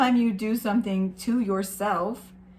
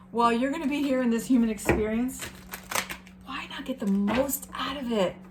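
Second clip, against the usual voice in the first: usual speaking voice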